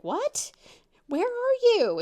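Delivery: high, loud, incredulous questioning; alarmed friend. Retold or imitated. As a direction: imitate a startled friend on the phone. Retold friend's alarmed phone reply.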